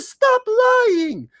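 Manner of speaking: squeaky high falsetto for the woman; mock woman's voice. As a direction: jump way up into a mock old lady's squeal. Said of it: "She says".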